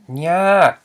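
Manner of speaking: nya drawn out, high then dropping; long arched nya; falling tone. Shown as a demonstration. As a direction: say nya slow and loud with a falling pitch. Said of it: "it is a falling tone".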